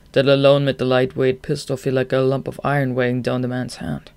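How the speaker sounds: ordinary delivery, nothing marked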